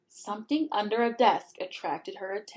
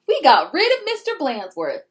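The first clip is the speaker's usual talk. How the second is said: put-on gleeful kids; 5 semitones higher, 6 dB louder than usual. Class cheering in story.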